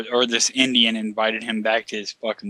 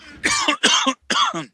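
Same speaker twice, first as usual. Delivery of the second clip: coughing fit; 3 dB louder than usual. Real, not acted. Still choking on drink.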